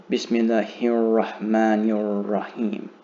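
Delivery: slow, connected "Bismillahir Rahmanir Rahim" with each word flowing into the next; measured teaching recitation of "Bismillahir Rahmanir Rahim", fully joined; words run together. Shown as a demonstration. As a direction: link "Bismillahir Rahmanir Rahim" slowly, no gaps between words. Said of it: "gonna sort of run into each other".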